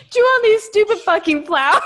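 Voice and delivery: loud high shout through laughter; laughing yell. Real, not acted. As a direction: cackle the line out. Friend laughing repeat.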